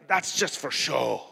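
put-on foreign accent, dismissive; Reinhard Bonnke accent. Preacher quoting evangelist on screaming, in foreign accent. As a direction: quote him in the imitated accent. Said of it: "he's got this accent".

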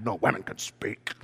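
low, hushed indignant protest; mock mad. Woman in the room objects, low mock-mad protest. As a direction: protest under the breath, half playing angry. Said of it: "You should just get mad".

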